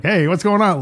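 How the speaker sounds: hammed-up old stage voice; theatrical old-style acting. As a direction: overact it like old movies. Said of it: "that kind of theatrical".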